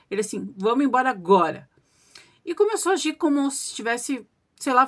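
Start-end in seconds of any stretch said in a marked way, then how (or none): 0.6-1.7 lightly voiced firm order; imitated gruff voice. Changed husband insisting his wife leave now, imitated gruff voice. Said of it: "de uma voz grossa".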